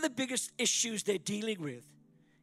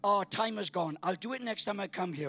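usual speaking voice

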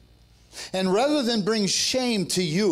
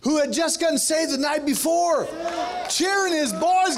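high-pitched preaching at full force; fervent pulpit shout; rousing preaching; 10 semitones higher than usual. Real, not acted. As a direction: build excitedly toward the climax. Preacher builds to a climax.

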